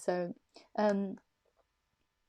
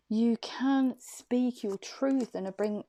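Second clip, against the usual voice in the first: ordinary talk, nothing marked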